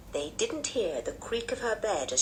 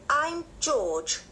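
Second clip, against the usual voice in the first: lightly voiced, firm child, pitched up; assertive tomboy; 8 semitones higher than usual. A narrator's reading. George correcting her new cousin, lightly defiant. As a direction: declare the name with a touch of defiance.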